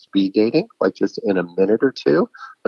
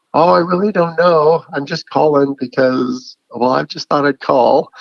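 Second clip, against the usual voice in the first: higher, slowed, vague caller voice; aimless caller; 6 semitones higher than usual. Retold or imitated. Imitating a caller with no reason, vague and high. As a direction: play the caller who doesn't know why they rang. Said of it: "And they go".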